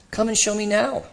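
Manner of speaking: high, merry invitation; a lilting, amused challenge; laughter in voice. A narrator's reading. Spirit teasing the ghost to show him, amused. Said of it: "with laughter in his voice".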